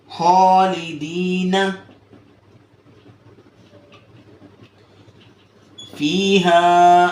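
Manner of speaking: خالدین فیہا drawn out very slowly; slow Quranic recitation. Shown as a demonstration. Teacher models Quran words, slow careful recitation. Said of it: "اور اسی طرح".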